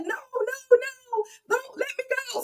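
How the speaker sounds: high-pitched imitation of panicked wailing; mock screaming plea. Host mimicking Madam screaming and yelling in handcuffs, high mock wail. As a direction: play-act a squealed plea, without real volume. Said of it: "screaming and yelling".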